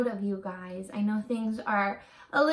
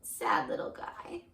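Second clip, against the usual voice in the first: whispered, lower, with soft pity; 7 semitones lower, 5 dB quieter than usual. Real, not acted. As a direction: whisper it like you feel sorry for him. Pitying the sad dog picture.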